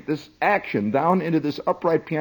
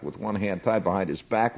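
ordinary talk

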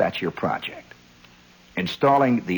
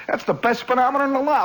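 ordinary talk

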